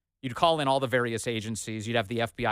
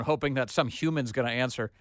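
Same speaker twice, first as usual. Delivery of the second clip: usual speaking voice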